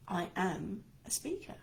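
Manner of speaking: "am" stressed and held before "a speaker". Shown as a demonstration. Coach showing an affirmation with shifted emphasis, stress on "am". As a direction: lean firmly on the word "am". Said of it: "Put the emphasis on the different words".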